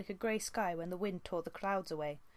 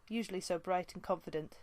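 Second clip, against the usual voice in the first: ordinary delivery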